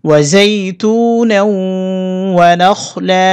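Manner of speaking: recited together. Shown as a demonstration. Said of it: "একসাথে বলে দিচ্ছি".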